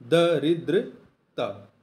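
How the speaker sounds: very slow "दरिद्रता", each syllable drawn out; slow spelled-out word. Shown as a demonstration. Teacher to exam students, drawing out दरिद्रता slowly. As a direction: spell out "दरिद्रता" with a short द at the start. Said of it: "दा पे आ की मात्रा नहीं रहेगा".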